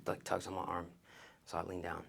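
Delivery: ordinary talk, nothing marked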